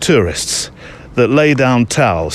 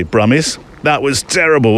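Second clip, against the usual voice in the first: ordinary talk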